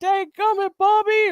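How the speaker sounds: high, exasperated twangy cartoon dad; a quick mock Hank Hill outburst; Hank Hill impression. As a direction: grumble it like a frustrated Texan dad. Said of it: "That's the guy that goes".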